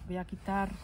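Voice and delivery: normal talking voice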